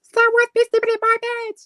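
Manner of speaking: put-on very high squeaky baby bear voice. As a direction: squeak it like a tiny bear cub. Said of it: "you wanna hear my Goldilocks voice".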